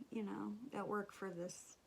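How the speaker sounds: ordinary talk, nothing marked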